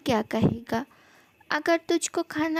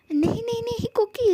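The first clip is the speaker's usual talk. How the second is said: pitched-up flustered denial; hurried protest; 7 semitones higher than usual. Acted. To Jungkook, refusing a doctor, a hurried high protest. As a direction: brush off the worry in a hurry.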